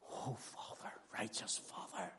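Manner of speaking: whispered, aching plea; a broken, whispered call to the Father; pathos-filled whisper. Shown as a demonstration. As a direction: barely breathe the prayer. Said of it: "The pathos of his heart".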